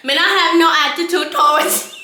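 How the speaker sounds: loud, high mock Rastafarian accent; Rastaman accent. Woman answering the Rastaman, loud mock Rasta accent. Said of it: "in this goddamn accent".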